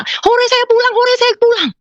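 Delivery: very high-pitched, gleeful cheering; joyful cheer. Retold or imitated. Woman cheering to God that she is going home, joyful. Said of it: "Saya gembira".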